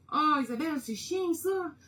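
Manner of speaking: high, loud mock complaint; playful exasperation. Real, not acted. Painter complaining to Isabelle about choosing colours, playful exasperation. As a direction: wail it in playful frustration, about to laugh.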